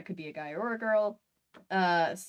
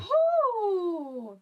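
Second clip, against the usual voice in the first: surprised; 8 semitones higher than usual. Real, not acted. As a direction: blurt it out in surprise. Startled reaction.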